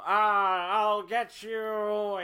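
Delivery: high, slow cackling cartoon villain; hammy evil villain taunt; Skeletor villain voice. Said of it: "That is the farthest thing from a Loki voice".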